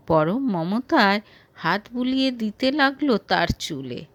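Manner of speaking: ordinary delivery, nothing marked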